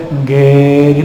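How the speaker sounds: "Engge" drawn out slowly on the last vowel; Javanese polite yes. Shown as a demonstration. Preacher switches to Javanese for yes, long slow "Engge". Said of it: "saya pakai bahasa Jawa deh".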